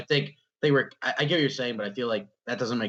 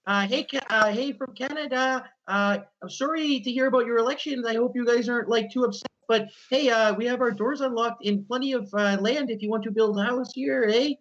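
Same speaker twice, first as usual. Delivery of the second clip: much higher, overly polite Canadian voice; Canadian accent; 12 semitones higher than usual. Pretend. Greeting Americans from Canada, a high polite Canadian accent. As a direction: chirp it like a sweet Canadian neighbour. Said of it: "Canadian, be like".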